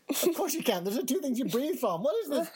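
high indignant disbelief; incredulous. Real, not acted. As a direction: scoff in amazement.

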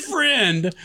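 "Friend." said through laughter; giggling out the word "Friend"; laughing. Real, not acted. Host laughing while quoting the driver saying "Friend". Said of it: "that's funny".

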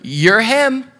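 retold wife, pitch jumping up on the last words; drawn-up, pointed emphasis at the end; wife's teasing realization. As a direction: lightly mimic a wife pointing out the likeness. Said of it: "my wife is like".

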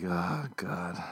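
breathless horrified whisper; a barely voiced groan of regret; whispered dismay. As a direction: mutter it under your breath, appalled. Realizing lie was believed.

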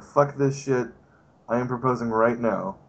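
ordinary delivery

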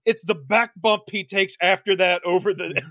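ordinary talk

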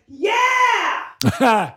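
high, drawn-out heckler's "yeah" yell; delighted yell. Retold or imitated. Comic imitates the audience guy yelling his delight at a joke, delighted yell. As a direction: imitate a fan hollering approval. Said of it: "yell their delight instead of laughing".

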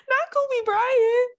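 drawn out in disbelief; mock disbelief. Real, not acted.

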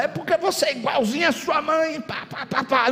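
high-pitched mock marital jab; nagging husband. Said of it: "e o marido fala".

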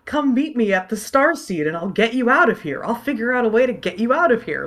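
optimistic. Retold or imitated. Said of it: "she even says".

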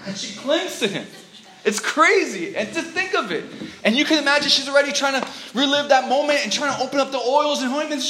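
fiery sermon climax; preaching at full force. Acted. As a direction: build the crowd up, proclaiming. Preaching to the congregation.

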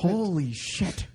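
drawn-out, slow mock astonishment; stunned reaction. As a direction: stretch it out as if freshly spooked. His stunned reaction to the warning.

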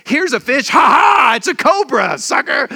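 father tricking his son with a cobra, mock laugh and shout; prankster father. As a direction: cackle and shout like a dad pulling a cruel joke. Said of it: "the biggest prankster kind of father".

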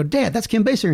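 high-pitched, astonished report; mock amazed discovery. Son telling Dad who the actress is, high mock amazement. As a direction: pitch it up as a sudden realization.

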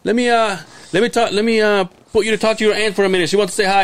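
high, hurried dad voice; imitating dad. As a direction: rush it like he wants off the phone. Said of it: "And he goes".

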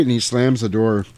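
ordinary delivery

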